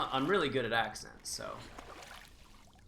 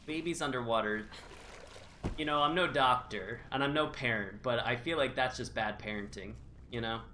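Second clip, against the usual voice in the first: ordinary talk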